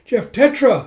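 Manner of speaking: loud high call; excited exclaim. Real, not acted. Narrator reacting to Tetra's arrival, loud and excited. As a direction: burst out with the name.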